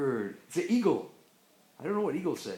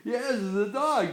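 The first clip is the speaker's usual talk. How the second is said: normal talking voice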